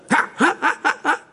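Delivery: high spelled-out laugh; high mock laughter. Pastor laughs at his baby voice, high.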